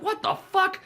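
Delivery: very high, loud indignant squawk; mock outrage. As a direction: imitate offended women in a high voice. Carolers' outraged reply, retold.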